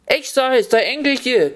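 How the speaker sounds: high-pitched yell without much volume; mock yell. A narrator's reading. Fox answering the deaf old woman, mock high yell. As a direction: raise the pitch as if shouting to the hard of hearing. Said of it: "krischt der Fuchs".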